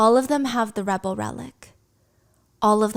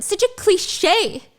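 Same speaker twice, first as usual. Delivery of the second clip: mocking sneer; 10 semitones higher, 3 dB louder, much slower than usual. Violet mocks Xaden.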